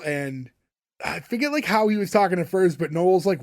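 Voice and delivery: usual speaking voice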